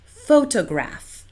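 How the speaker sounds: photograph stressed on the middle, pho-TO-graph, said slowly; wrong stress. Shown as a demonstration. Teacher imitating a Singapore English stress error. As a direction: lean on the second beat of photograph. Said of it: "I often hear".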